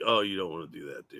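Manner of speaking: low, quiet, quick muttered imitation; mock a reluctant mutter under your breath; George. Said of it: "you could hear it in George's voice".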